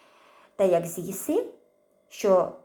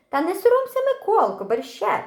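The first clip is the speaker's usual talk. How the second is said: high, louder sing-song urging; coaxing aunt; 10 semitones higher, 5 dB louder than usual. A narrator's reading. Aunt urging the boy at the table, high coaxing voice. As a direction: press the boy to eat, sweetly insistent. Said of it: "припрошує дядина".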